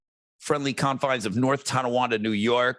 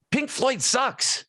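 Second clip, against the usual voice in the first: mock scandalized; 12 semitones higher, much slower than usual. Mocking the podcast title.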